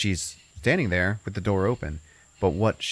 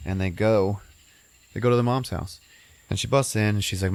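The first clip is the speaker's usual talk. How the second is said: ordinary talk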